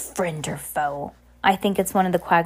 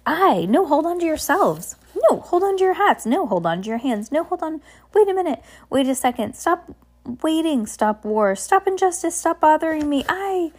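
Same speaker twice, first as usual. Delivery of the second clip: high, lively sailor voice firing off jumbled orders; a cheerful, scatterbrained captain contradicting himself; scattered cheerful captain; 7 semitones higher than usual. Acted. Same captain voice continues.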